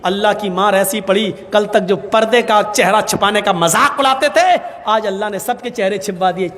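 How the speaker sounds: ordinary talk, nothing marked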